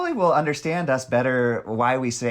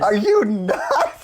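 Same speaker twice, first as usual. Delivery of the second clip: high, loud, slow quote through laughter; mock outraged yell; 10 semitones higher, 5 dB louder, much slower than usual. Friend repeating the film line to the group, mock outraged yell. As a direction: stretch the shouted question out, amused.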